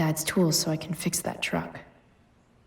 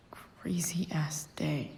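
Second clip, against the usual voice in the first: slow, much softer whisper trailing off; 10 dB quieter, much slower than usual. Real, not acted. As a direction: breathe it out slowly to yourself. Muttering to herself in the game.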